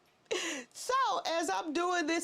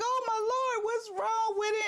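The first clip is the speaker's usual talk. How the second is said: high, distressed wail to the Lord; panicked cry; 7 semitones higher than usual. Retold or imitated. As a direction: voice cracking with worry. Panic over dropped camera.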